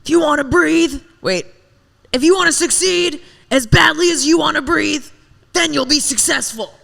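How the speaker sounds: high, loud preachy hype voice; motivational speaker impression. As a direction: pump the crowd up like a guru. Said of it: "And then Eric Thomas".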